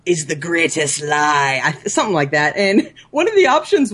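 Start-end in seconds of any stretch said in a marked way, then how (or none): 0.0-1.6 slow, eerie talking door; spooky door asking slowly; creepy door voice. Retold or imitated. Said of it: "door that scares the crap out of me".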